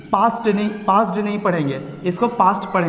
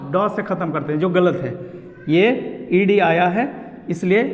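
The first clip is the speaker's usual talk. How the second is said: ordinary delivery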